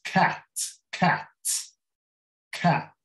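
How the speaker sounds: Cat repeated with a wide vowel between A and E; almost open A. Shown as a demonstration. Teacher drilling Cat for learners, almost open A. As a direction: repeat Cat singly, opening the A. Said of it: "É quase um A aberto".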